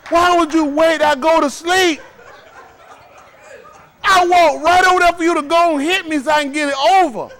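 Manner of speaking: high, loud comic wail; mock frantic pleading. Kid begging parent to hit and be done, mock frantic. Acts out child dreading delayed punishment.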